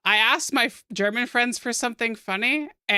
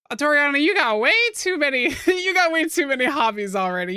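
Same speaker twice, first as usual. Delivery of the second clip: higher, louder playful telling-off; mock self-scolding; 6 semitones higher, 4 dB louder than usual. Reminding myself before learning another language, mock self-scolding. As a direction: scold yourself lightly, with a laugh midway. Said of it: "I have to remind myself".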